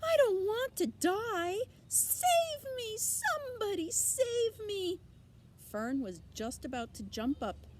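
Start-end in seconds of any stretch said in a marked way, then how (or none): 0.0-5.0 very high, loud, frantic pleading; terrified pig wailing for rescue; panicked screaming. Acted. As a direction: scream for help like a doomed piglet. Terrified pig begs for life.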